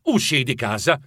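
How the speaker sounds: ordinary delivery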